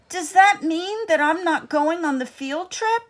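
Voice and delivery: a small mouse fearing he's left behind; worried little mouse. A narrator's reading. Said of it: "Fergus thought".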